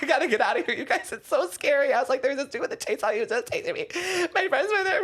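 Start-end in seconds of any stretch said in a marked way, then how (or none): 0.0-1.9 high-pitched whimpering plea; high, frightened wail, played for laughs; mock panicked crying. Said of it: "I'm, like, crying". 2.2-5.0 very high tearful whine; retold sobbing panic, climbing higher to the end; mock crying. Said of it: "crying to the, I'm crying to them".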